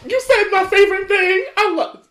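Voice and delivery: high, loud burst of delight; real excitement. Real, not acted. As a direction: squeal over a favorite food. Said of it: "You got me so excited".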